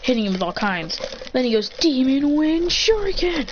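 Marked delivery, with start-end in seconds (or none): 1.8-3.5 high, slow, drawn-out battle cry; attack call. Acted. Fighter hurling his shuriken, drawn-out attack call. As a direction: call out the move's name grandly.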